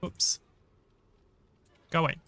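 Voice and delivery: ordinary talk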